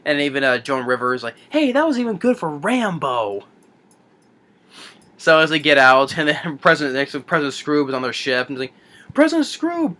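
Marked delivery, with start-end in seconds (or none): 1.5-3.4 high-pitched mock impressed quip; Joan Rivers. Retold robot reacting to the shooting, mock impressed. 9.1-10.0 high-pitched call to attention; retold salute. Retold crew greeting their President, hammed-up salute.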